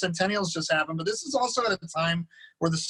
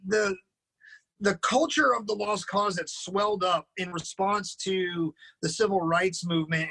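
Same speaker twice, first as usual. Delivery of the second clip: ordinary delivery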